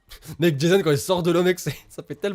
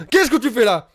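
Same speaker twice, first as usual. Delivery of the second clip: mock threatening; 7 semitones higher, 7 dB louder than usual. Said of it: "je déconne, je déconne".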